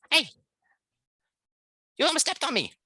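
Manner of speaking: very high, energetic mouse voice; manic little mouse. Acted. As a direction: pipe up like an indignant tiny mouse. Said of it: "tiny little body of a mouse".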